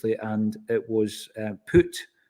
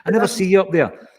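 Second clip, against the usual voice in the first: laughing retelling; 8 semitones higher, 7 dB louder than usual. Retold or imitated. Retelling the manager teasing Paul, laughing and louder. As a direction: repeat the manager's line through laughter, higher and louder. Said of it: "this is the funny bit".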